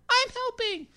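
very high, squeaky cartoon kid; Ralph voice. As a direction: squeak it out like a little cartoon boy. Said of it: "him saying".

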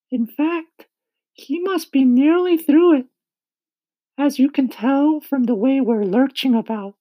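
high-pitched, loud character voice; kindly ladybug. A narrator's reading. Ladybug telling James of their departure, high, loud voice. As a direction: speak up high as an eager ladybug.

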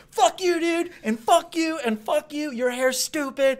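high, loud hammed-up rant; mock tirade at full volume; retold angry yelling. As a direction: lash out at the audience. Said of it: "I start yelling at people".

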